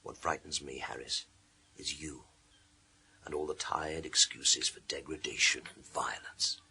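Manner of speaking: hushed and cold, barely voiced; low whisper. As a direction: whisper it with quiet disgust. Quiet contempt facing the killer.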